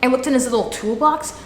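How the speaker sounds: ordinary talk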